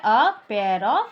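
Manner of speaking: very slow, each word drawn out; slow dictation. Shown as a demonstration. Teacher recapping the phrase, slowly dictated. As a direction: spell it out slowly for the class. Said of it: "क्या लगता है".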